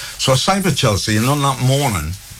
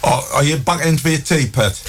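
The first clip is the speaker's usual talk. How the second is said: higher, fond Geordie mum; a mum fussing over her boy's tea; mum's voice; 7 semitones higher than usual. Retold or imitated. Mum asks her son home for tea, higher fond voice.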